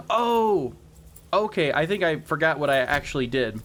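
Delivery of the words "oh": surprised gasp. Real, not acted. Sudden realization in menu.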